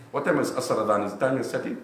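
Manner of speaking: ordinary delivery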